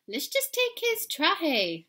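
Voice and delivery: high, slow little dog voice; suggesting. Acted. Pup suggesting to friends, high and slow. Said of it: "suggested Poquito Tito".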